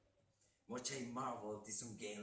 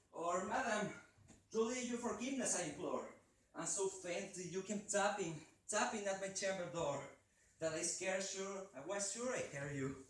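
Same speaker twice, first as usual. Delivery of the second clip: raised, higher call to the door; loud call; 8 semitones higher, 5 dB louder than usual. Acted. Speaker calling through the door, raised and high. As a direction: call out to an unseen visitor. Narrator addresses visitor loudly.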